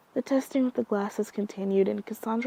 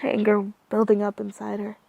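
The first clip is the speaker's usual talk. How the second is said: ordinary delivery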